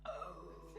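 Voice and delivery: low, soft imitated rumble "Oh"; deep stomach noise. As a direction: make the belly's deep groan. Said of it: "makes a really deep noise".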